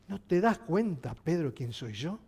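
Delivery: soft, low ask. Acted. Jesus quietly asks Pedro if he sees who he is, soft and low. Preacher repeats Jesus' question.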